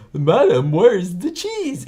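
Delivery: loud, high, drawn-out demand; hammed-up, high-pitched TV quote; mock cheese demand. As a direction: call it out high and stretch out CHEESE. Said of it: "[name] goes with his weird accent".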